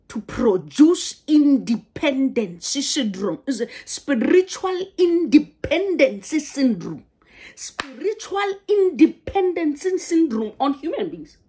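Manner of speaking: loud, high-pitched preaching emphasis; emphatic rebuke. Real, not acted. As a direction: hammer each phrase home to the church. Preacher hammering home a rebuke.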